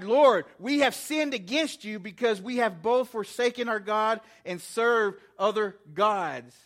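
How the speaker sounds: crying out. Retold or imitated. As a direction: cry it out to the Lord, imitating the people. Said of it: "they're crying out".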